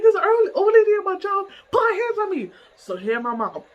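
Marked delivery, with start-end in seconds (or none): 0.0-2.5 retold wailing complaint. Daughter reporting being touched to mom, high wail. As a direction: wail the whole grievance.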